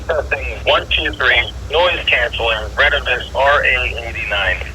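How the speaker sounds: digital radio sound; as if over a digital handheld radio; over walkie talkie. Shown as a demonstration. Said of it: "You can hear the digitalization".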